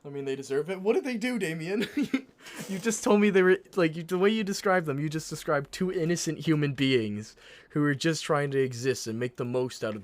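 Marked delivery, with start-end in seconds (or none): 0.8-2.3 high, loud disbelieving laugh; incredulous. Real, not acted. 2.7-10.0 loud, higher scolding rant; loud outraged rant. Real, not acted. Mock-outraged rant at friend.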